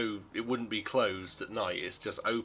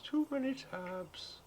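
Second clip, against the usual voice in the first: high slow mutter; 5 dB quieter, slower than usual. Shown as a demonstration. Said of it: "too many tabs for this place".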